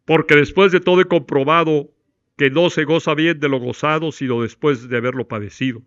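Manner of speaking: loud, sweeping recital of a sonnet; poem recital in one breath. Shown as a demonstration. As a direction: declaim the whole poem on a single breath. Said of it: "Con una sola inhalación, todo el poema".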